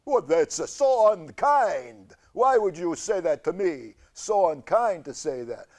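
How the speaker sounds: hurt cancer voice. Acted. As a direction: play the offended tumor. Cancer voice pouts.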